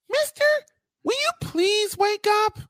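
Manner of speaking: very high, squeaky teasing call; [name] voice. Acted. Wife records his show opening in her funny voice. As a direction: chirp it like a playful wife waking him. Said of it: "Do it the way [name] can do it".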